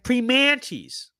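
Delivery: "Primanti's" drawn out with a high, stretched MAN; exaggerated attempt at local "Primanti's"; local-style pronunciation. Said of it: "that's how they say it".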